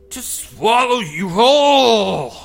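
high, slow, loud creature shout; menacing creature. Acted. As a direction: roar it slowly at him. Creature answers the boy.

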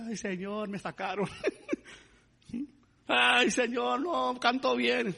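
wailing complaint, imitating someone sobbing; mock crying. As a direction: imitate a tearful plea, rising into a drawn-out wail. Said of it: "estaba llore, llore, llore".

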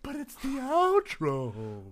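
very high, slow whining host; high whiny outro host voice; outro man whine. Pretend. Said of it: "outro man".

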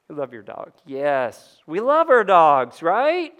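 normal talking voice

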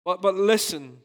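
ordinary talk

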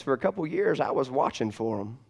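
usual speaking voice

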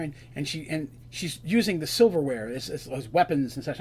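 ordinary talk, nothing marked

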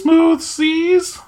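drawn-out, squeaky high fairy voice; fairy commodore. Acted. Game master repeats the line that amused a player, high drawn-out fairy voice. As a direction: stretch it out loud in a tiny high voice. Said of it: "Smooth seas got me for some reason".

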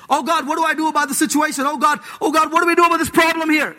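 fast, rising, frantic Oh God pleas; mock panicked praying. Preacher mocking a frantic church member, panicked and fast.

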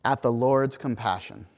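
measured, formal Bible reading to the congregation; scripture reading. Acted. Pastor reads Jonah to the congregation, formal scripture reading. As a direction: read it out as the lesson.

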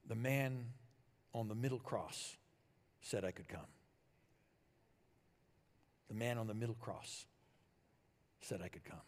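soft, low, with long pauses; hushed answer. Acted. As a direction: give the simple answer quietly and solemnly. Thief's simple answer, the climax.

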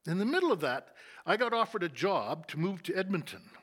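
normal talking voice

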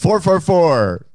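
loud announcement. Real, not acted. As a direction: belt out each number deliberately. Defiant shout of number after teasing.

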